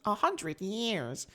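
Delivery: high, slow, soft pout; pouting Aang. Retold or imitated. Aang pouting outside, slow high sulk. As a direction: sulk it out slowly. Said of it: "he pouts".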